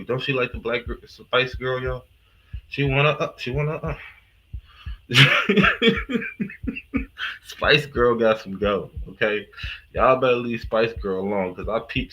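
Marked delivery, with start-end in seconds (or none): 4.9-7.4 long high-pitched cackle; high laugh. Real, not acted. Vlogger cracking up about Spice Girl, high laugh.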